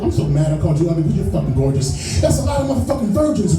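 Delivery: ordinary talk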